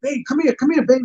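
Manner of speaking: high-pitched calling-over voice; urgent girlfriend. Acted. Girlfriend flagging her boyfriend over, high and urgent.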